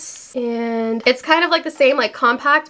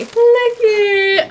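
high, delighted cry; excited squeal; 9 semitones higher, 4 dB louder than usual. Real, not acted. Watching the dog with the plush, excited squeal. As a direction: squeal to point out something adorable.

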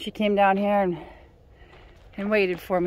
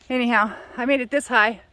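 ordinary delivery

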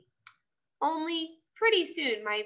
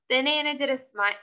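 ordinary delivery

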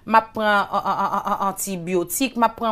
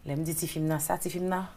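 ordinary delivery

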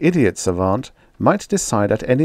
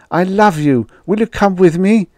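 desperate plea; 10 semitones higher, 5 dB louder than usual. A narrator's reading. Pleading with the woman.

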